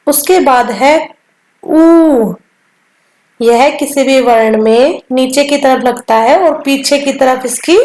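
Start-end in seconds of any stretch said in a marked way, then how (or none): none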